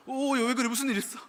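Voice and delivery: high, loud startled questions; startled reaction. Acted. Teacher reacting to a student's scream, acted startled alarm.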